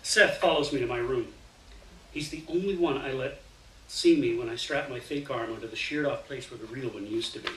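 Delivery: plain reading voice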